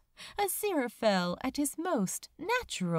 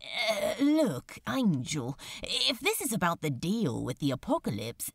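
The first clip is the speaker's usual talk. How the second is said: flustered. A narrator's reading. Crowley flustered, deflecting.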